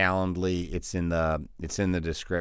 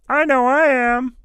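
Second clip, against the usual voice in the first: a squeal far higher and louder than his own; high squeaky put-on voice; 9 dB louder than usual. Coach answering his own question in a squeaky cartoon voice. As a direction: pop up as a shrill cartoon character.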